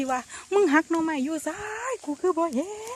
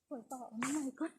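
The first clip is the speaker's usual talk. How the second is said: whispered so nothing hears; hushed whisper, careful not to scare it; whispering to the camera while hiding; 11 dB quieter than usual. Real, not acted. Hiding from critter, keep quiet.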